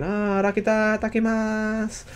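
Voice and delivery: put-on high, slow cute anime girl voice; imitating Madeira. Fan copying Madeira's cute speech, slow and high. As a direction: mimic her soft cute way of speaking. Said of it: "The way she speaks is cute".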